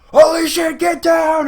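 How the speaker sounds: high, loud mock alarm; mock panicked shout. Imagined bystander warning others of danger, mock panicked shout.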